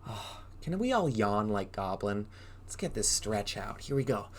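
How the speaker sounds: ordinary delivery, nothing marked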